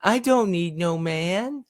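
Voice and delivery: high put-on girly cheer; mocking cheer. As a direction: squeal it out in mockery.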